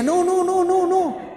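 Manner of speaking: high, rapid-fire protesting no's; mock alarmed refusal. Blind man refusing the healing prayer. (protesta fingida en voz aguda)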